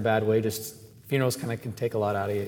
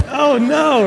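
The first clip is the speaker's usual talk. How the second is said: mock horror; 13 semitones higher, 9 dB louder than usual. Priest's reaction to hell remark.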